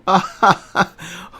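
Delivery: big high-pitched belly laugh; high roaring laugh. A narrator's reading. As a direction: laugh like the greatest laugh in the world. Remy's great laugh.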